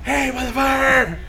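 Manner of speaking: high, slow, drawn-out holler; demonstrated yell. Shown as a demonstration. Warning whoever is on the train, demonstrated yell. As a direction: call out a loud warning down the train. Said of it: "I yell".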